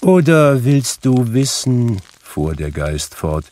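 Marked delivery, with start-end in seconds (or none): 0.0-2.0 lightly voiced ghost, higher and louder; a hint of spectral threat; menacing ghost. A narrator's reading. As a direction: challenge him as a stern spirit. Narrator voices Marley's ghost.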